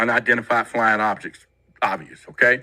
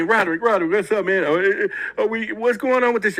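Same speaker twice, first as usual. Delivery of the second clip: high, pleading voice of a rattled friend; panicked caller; 8 semitones higher than usual. Retold or imitated. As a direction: imitate a grown man panicking over the phone. Grown men panicking on phone calls.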